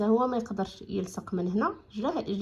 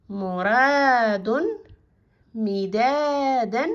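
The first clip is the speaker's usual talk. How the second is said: each word called out very slowly and louder for the class to repeat; slow loud word drill; 4 dB louder, much slower than usual. Shown as a demonstration. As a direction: lead the children through the list, word by word. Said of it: "نعاودوهم".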